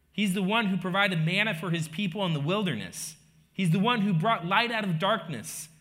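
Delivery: loud, high, driving proclamation; full-force preaching. Acted. Preacher declares who Jesus is to the congregation, proclaiming forcefully. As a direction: proclaim each "He's" line with rising conviction.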